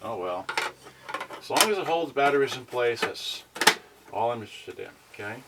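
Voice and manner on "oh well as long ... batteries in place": ordinary talk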